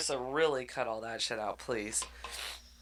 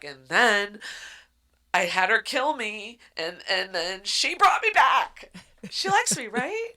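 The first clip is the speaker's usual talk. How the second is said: excited gushing; 7 semitones higher, 6 dB louder than usual. Acted. Dreamy character gushing.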